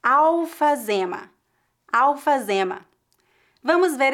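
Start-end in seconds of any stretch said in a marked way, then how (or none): 0.0-1.3 slow, careful Alfazema, afazema; pronunciation demo. Shown as a demonstration. Teacher modelling Alfazema for students to repeat, slowly. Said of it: "Repita comigo para praticar sua pronúncia". 1.9-2.8 Alfazema said clearly, awfazema; pronunciation demo. Shown as a demonstration. Teacher modelling Alfazema for students to repeat. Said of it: "Repita comigo para praticar sua pronúncia".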